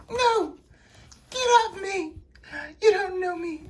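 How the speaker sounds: very high-pitched frantic screech; frantic Veronica. Acted. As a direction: fight them off in a piercing high voice. Possessed Veronica fights him off.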